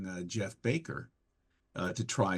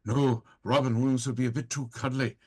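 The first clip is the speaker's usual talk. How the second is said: high, loud mad-god menace; gleefully threatening madman, voice pitched up; Sheogorath voice; 5 semitones higher, 4 dB louder than usual. Acted. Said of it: "And I thought".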